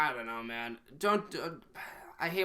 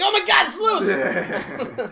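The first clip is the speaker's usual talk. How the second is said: high, loud, drawn-out comic shriek; mock panicked scream; 9 dB louder, much slower than usual. Comedian tossing a prop into the crowd, mock shriek. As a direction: scream it in hammed-up alarm. Acts out alarm at tossed prop.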